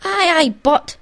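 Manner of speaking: loud, high interjection; desperate attempt to be heard. A narrator's reading.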